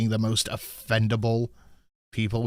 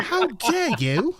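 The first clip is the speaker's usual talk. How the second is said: high, drawn-out affront; hammed-up scandalised cry; mock outrage; 13 semitones higher, much slower than usual. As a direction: stretch it out, theatrically offended. Mock offence in the British bit.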